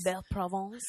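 cartoonish French accent; Lumiere. Echoing cohost's French, Lumiere-like French accent. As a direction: lay on a cheesy French accent. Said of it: "If I do it like Lumiere".

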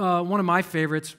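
usual speaking voice

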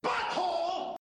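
high squeaky shouted Butthole; silly catchphrase. Pretend. Podcast host yells the running gag in a squeaky voice. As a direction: blurt it in a squeaky cartoon voice.

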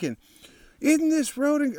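usual speaking voice

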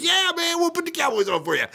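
high, rushed mock-waiter voice; imitating an over-eager server.

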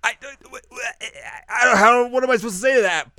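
high, fast, flustered stammer; stammer, embarrassed by praise. Retold or imitated. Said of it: "Ritsu's like".

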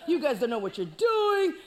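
loud bragging shout; hammed-up giant boast; boastful giant. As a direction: brag at the whole army. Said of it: "talking all this mess".

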